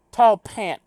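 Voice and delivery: "Tall pant" shouted high and loud. Acted. Loud item name again.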